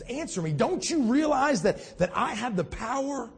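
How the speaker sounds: high-pitched, exasperated authority; indignant demand. Acted. Pilate demanding an answer from silent Jesus, indignant demand. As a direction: demand a reply, leaning on the words.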